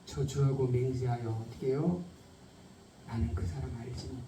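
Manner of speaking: very soft, low, sombre delivery; hushed denial. Acted. Preacher retells Peter's denial to the congregation, hushed and low. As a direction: sink to a near murmur for the denial.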